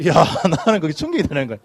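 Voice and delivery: ordinary talk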